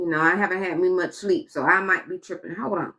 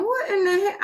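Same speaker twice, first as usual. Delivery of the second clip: disbelief; 10 semitones higher than usual. Real, not acted. Reacting to outrageous prices.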